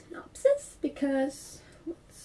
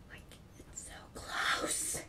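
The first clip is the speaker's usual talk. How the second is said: whisper; 11 dB quieter, slower than usual. Real, not acted. Excited whisper about the show.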